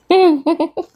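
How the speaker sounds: short, high-pitched chuckle; amused chuckle. Real, not acted. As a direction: chuckle at the grumpy pet. Amused at angry pet bird.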